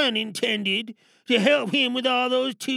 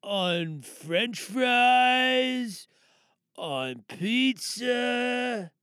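slow drawn-out list; much slower than usual. Acted. Snaps back, slow list.

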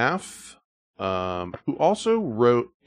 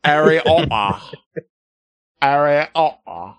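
mock British accent; 2 semitones higher, 4 dB louder than usual.

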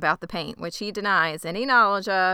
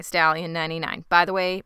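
ordinary talk, nothing marked

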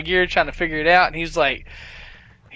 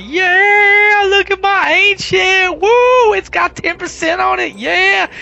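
much higher and louder, hammed-up whooping gloat; mock excited bragging; 11 semitones higher, 6 dB louder, slower than usual. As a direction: crow over your new loot like a kid. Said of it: "and you're like".

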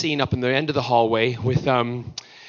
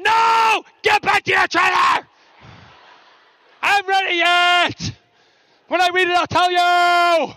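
hammed-up outburst, much higher; mock panicked shouting; 14 semitones higher than usual. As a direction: shoo him away before spoilers. John shouting back, mock.

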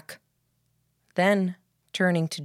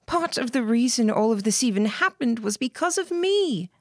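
lightly voiced girl, higher pitch; a hint of a higher girlish voice; Emily's voice; 7 semitones higher than usual. Emily answers Lillian's plea.

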